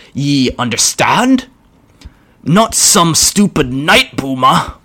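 a brutish orc taking his time. Acted. Orc voiced.

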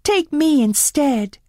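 slow, high and earnest; pleading offer. Acted. Belle offers herself to the beast instead, earnest plea. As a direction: offer yourself in his place. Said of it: "she begged".

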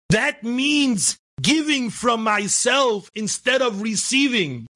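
measured, impassioned exhortation; building sermon. Real, not acted. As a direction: draw out each phrase and climb to a high cry. Preaches, building in pitch.

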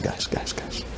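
near-whispered, very soft appeal; hushed calming. Retold or imitated. Leader quieting panicking companions, hushed. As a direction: settle them down under your breath.

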